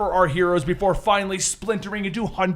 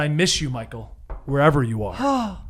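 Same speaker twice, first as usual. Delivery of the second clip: a touch of wistful grief; 5 semitones lower, 5 dB quieter than usual. Acted. Ted Kord mourns friend.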